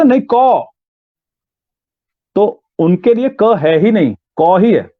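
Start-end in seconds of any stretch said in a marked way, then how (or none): 0.0-0.6 "कौ" said higher, insistent; father insists on कौ. Retold or imitated. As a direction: stubbornly repeat "कौ". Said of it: "कहता". (ज़िद में "कौ")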